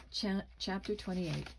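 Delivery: ordinary delivery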